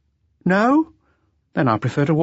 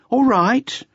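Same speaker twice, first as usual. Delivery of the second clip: impatient repeat; 4 dB louder than usual. A narrator's reading. Repeated louder.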